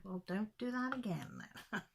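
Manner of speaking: dry whispered telling-off; whispered retort. Retold or imitated. Mum teasing her daughter after the nosebleed, whispered. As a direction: breathe it out as a wry scolding.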